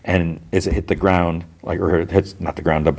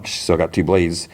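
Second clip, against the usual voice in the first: normal talking voice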